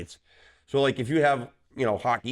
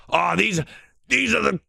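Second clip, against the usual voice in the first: put-on high, loud, sneering kid voice; mocking the kids; 11 semitones higher, 3 dB louder than usual. Referee mimics college kids dismissing the out-west refs, high and sneering. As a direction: mimic the players scoffing at western refs, pitched way up. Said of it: "but the kids".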